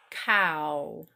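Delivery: "Cow" spoken slowly, lips starting wide and narrowing to a w; lip-shown ow sound. Shown as a demonstration. Teacher shows students her lips on "Cow", exaggerated. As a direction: open wide on "Cow", then round the lips. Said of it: "look at my lips while I speak it".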